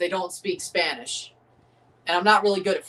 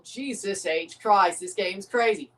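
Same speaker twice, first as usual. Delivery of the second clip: ordinary talk, nothing marked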